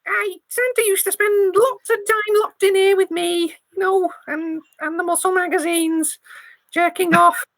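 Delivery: high, quick Scouse mouse squeak. Acted. GM as a Scouse mouse gossiping about Santa. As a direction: rattle it off as a Liverpool mouse. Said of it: "lost my Scouse accent".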